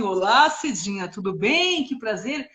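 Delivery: measured, courteous hello to a guest; polite greeting. Shown as a demonstration. Host greeting Cidinha as she walks in, polite and composed. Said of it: "eu ia falar assim".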